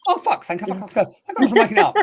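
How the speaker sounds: high, loud burst of relieved laughter; laughing relief. Real, not acted. Medium to sitter after being understood, laughing relief.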